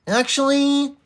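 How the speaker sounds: very high, drawn-out "actually"; nerdy know-it-all. Acted. Streamer testing a know-it-all voice on chat, very high "actually". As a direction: correct someone like an insufferable nerd. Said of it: "I think we might have it".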